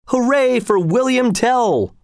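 the crowd roars approval of its new leader. A narrator's reading. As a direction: cheer him at the top of your voice. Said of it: "roared the crowd".

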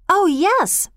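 high-pitched burst of realisation; excited cry. A narrator's reading. Girl realising they do have something red, excited cry. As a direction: seize on the idea, voice leaping up. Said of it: "Bobby cried".

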